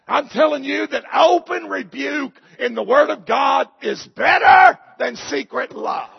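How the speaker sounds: loud, high, slowed preacher's cry; shouting the verse at the church; preaching shout. Acted. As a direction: hammer the verse home. Preaching climax.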